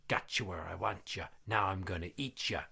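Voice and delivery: low, hushed ghostly threat; quiet menacing sing-out from the dark; spooky voice. Acted. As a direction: drop it low and soft, like a voice haunting a room. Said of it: "So he hears".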